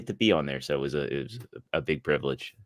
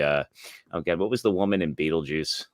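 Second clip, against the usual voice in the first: normal talking voice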